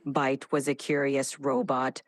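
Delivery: low, dramatic robot monotone; robotic voice. Shown as a demonstration. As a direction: speak like a dramatic machine. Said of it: "Initiating dramatic robotic voice".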